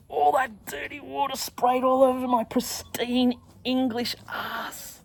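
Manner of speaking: high, drawn-out aggrieved squeal; slow, high comic disgust; mock horror. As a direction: stretch each word in high outrage. Mock horror at being sprayed.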